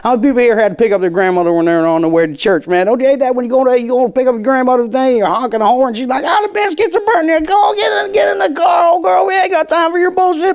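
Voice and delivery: high, loud, hammed-up Southern yokel greeting; hacky Southern accent. Comedian on stage to Southern crowd, hammed-up Southern accent. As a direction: play the Southern good old boy to win the room. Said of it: "doing the hackiest Southern bullshit".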